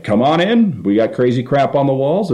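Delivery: high, eager mock welcome; welcoming restaurant voice. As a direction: pitch it up like an over-welcoming restaurant host. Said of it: "Applebee's was like".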